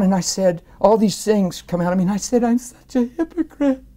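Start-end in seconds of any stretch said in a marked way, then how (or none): 2.4-3.8 high-pitched tearful wail, climbing on the repeat; bawling confession. Retold or imitated. Man standing at work confessing hypocrisy, bawling. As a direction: wail it as if sobbing, retold. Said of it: "I'm bawling".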